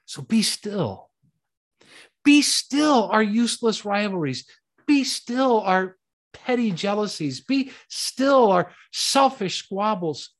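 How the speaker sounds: rising, emphatic sermon commands; preaching. Acted. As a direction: press each be still home from the pulpit. Preacher's be still refrain.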